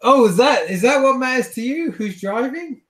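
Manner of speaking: high, loud mock-incredulous questioning; mocking challenge. Driver with hands off wheel challenges passengers, mocking. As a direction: taunt them with rising, high-pitched questions.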